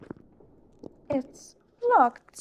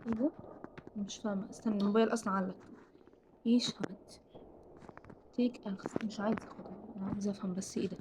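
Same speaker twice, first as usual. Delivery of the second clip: soft unvoiced hush, much softer; whisper; 5 semitones lower, 15 dB quieter than usual. Real, not acted. Gamer hiding from the nun, hushed whisper. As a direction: keep it down, scared whisper.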